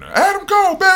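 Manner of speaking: high-pitched hype shout; excited catchphrase yell. Retold or imitated. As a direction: yell it out of nowhere. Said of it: "it just added".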